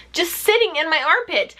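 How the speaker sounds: normal talking voice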